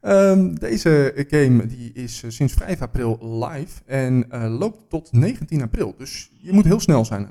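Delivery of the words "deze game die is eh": ordinary talk, nothing marked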